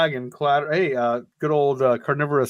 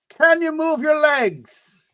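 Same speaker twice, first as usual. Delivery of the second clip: big, slow, much higher Irish brogue; hammed-up Irish brogue; 12 semitones higher, 6 dB louder, slower than usual. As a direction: ham up the Irish rescuer's brogue, drawing it out. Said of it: "in an Irish brogue".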